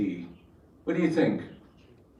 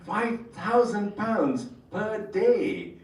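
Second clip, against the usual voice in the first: high, slowed stunned question; drawn-out incredulous repeat; mock astonishment at the fee; astonished; 7 semitones higher, much slower than usual. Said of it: "I started laughing".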